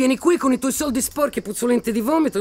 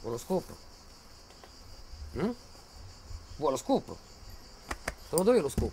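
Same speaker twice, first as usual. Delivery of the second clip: ordinary talk, nothing marked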